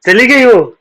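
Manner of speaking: high, loud astonished question; surprised disbelief. Real, not acted. Friend reacts to her leaving.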